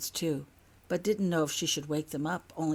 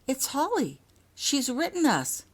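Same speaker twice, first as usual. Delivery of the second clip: higher, slower, amazed; overjoyed father; 9 semitones higher, 4 dB louder than usual. Acted. Father tells his wife their daughter wrote, amazed and joyful. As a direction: let the joy break through.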